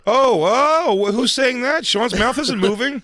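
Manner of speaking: hammed-up amazement, voice jumping high; mock shock.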